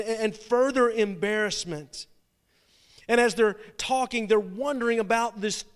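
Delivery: loud, driving sermon delivery, pushing ahead; preaching at full force. Acted. Preacher telling the congregation of the rush to the tomb, loud and urgent.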